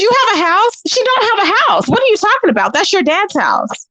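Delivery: high-pitched, scoffing disbelief; incredulous. Retold or imitated. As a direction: challenge her boast as absurd. Retold disbelief, mocking her claim.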